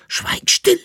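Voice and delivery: very high, loud outburst; sharp interruption. A narrator's reading. Jäckele-Narr interrupts the rhyming rant, sharply and loudly. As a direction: cut him off with a cry. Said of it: "unterbrach ihn der Jäckele-Narr".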